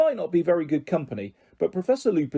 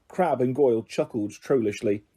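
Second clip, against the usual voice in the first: ordinary delivery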